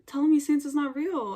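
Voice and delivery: ordinary delivery, nothing marked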